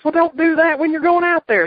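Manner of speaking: high, loud exasperated impression; Cornette impression. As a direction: squawk it like a cranky manager. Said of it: "He goes".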